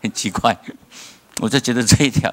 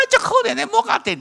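whiny despair; faster than usual. Pretend. Mock pitiful dying cry.

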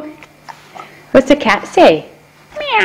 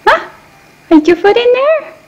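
much higher, gentle cooing; 8 semitones higher than usual. Real, not acted. Mother praising her toddler's foot in her shoe, high baby talk.

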